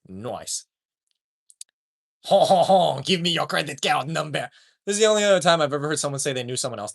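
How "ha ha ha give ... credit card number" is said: ho-ho laugh, then mock French demand; hammed-up French scammer; bad French accent. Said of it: "I can't do a French accent".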